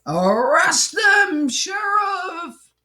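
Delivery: high, slow, furious cry at the sheriff; villain yelling for an arrest; angry villain shout. Acted. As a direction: bellow it like a mean old villain.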